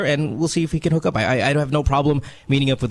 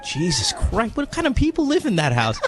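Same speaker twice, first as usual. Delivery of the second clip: high startled outburst; shocked; 9 semitones higher than usual. Real, not acted. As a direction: react in sudden disbelief. Shocked by dog noise.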